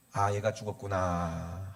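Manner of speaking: resigned realisation. Acted. Acted resigned realisation of death.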